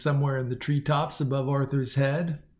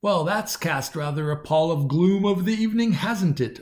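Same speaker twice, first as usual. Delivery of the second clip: usual speaking voice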